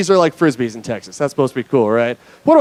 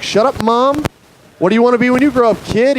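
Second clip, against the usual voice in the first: high, indignant comeback; defensive retort; 8 semitones higher than usual. Retold or imitated. Comedian retorts to kid like to mom, high and indignant. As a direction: snap back high, then fire the question straight back.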